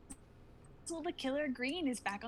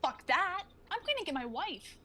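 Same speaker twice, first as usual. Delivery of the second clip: angry outburst; 7 semitones higher than usual. Acted. Fighter rejecting the plan to go get his wife, angry and higher. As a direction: spit it out in frustration.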